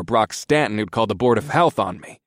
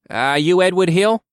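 higher, louder, slow formal question; timid inspector; 8 semitones higher, 5 dB louder than usual. Acted. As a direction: ask as a fussy little official, drawn out. Voices inspector character.

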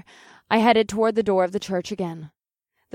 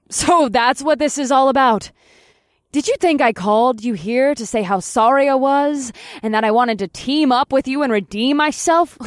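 louder, raised and scornful; scornful outburst; 5 semitones higher, 6 dB louder than usual. Acted. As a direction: throw her own assumption back at her. Dynamo scoffs at Helena.

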